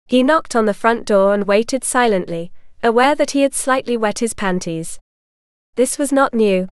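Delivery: ordinary delivery, nothing marked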